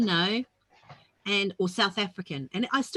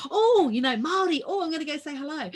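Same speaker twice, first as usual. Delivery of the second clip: higher and louder, eager at meeting another Māori; thrilled to hear a Kiwi greeting abroad; excited; 6 semitones higher, 4 dB louder than usual. Retold or imitated. Spotting a fellow Māori in Kingston, excitedly. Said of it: "and I".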